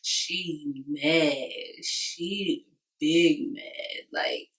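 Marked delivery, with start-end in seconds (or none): 1.8-4.0 a very slow, stretched She big mad; slow emphatic punchline. Real, not acted. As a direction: drag every word out for emphasis.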